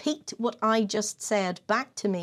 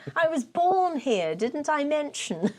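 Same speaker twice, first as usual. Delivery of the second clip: put-on Kensington accent; 4 semitones higher than usual. Playing an actress stuck in her fake Kensington accent. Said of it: "she has an amazing Kensington accent".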